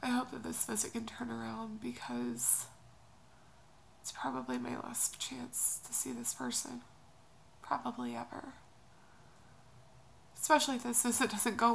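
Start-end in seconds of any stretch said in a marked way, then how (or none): 0.0-8.5 quiet and tearful. Real, not acted. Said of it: "I'm just feeling really emotional".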